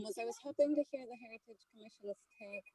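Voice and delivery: ordinary talk, nothing marked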